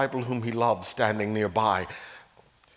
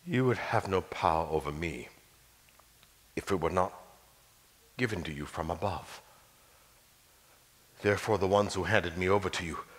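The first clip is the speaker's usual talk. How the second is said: softer, lower, ending in a whisper; calm answer; 4 semitones lower, 5 dB quieter than usual. Acted. Jesus answering Pilate, soft low calm voice. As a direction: answer quietly with calm authority.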